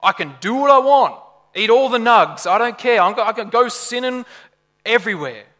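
carefree defiance. Acted. Preacher mimics someone who thinks grace allows anything, brashly carefree. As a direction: brashly throw off every rule. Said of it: "he's countering liberalism".

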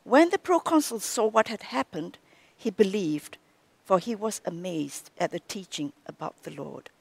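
ordinary delivery

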